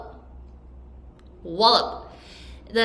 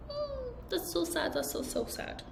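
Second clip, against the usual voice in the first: sad; 10 semitones higher, 12 dB quieter than usual. Real, not acted. Teacher reacts to cruel sentence.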